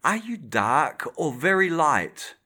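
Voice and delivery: weight the words "dark" and "light" differently; landlady shifting the stress on "dark" and "light"; emphasis varied. Acted. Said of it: "varying the emphasis".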